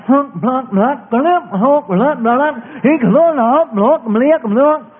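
high sing-song string of nonsense syllables; mock foreign gibberish. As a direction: imitate a repetitive language, bouncing high on every syllable. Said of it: "it sounded kinda like".

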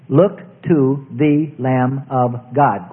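"Look to the Lamb of God" very slow, each word separate; deliberate, spaced-out "Look to the Lamb of God". Shown as a demonstration. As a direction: stretch out "Look to the Lamb of God" to show every sound. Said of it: "quite different".

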